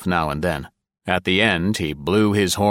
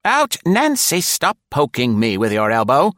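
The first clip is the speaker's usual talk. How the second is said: high put-on woman's voice, loud and sharp; high, loud sassy secretary; tart secretary Nora; 8 semitones higher, 4 dB louder than usual. A narrator's reading. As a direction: tease the boss from the back seat.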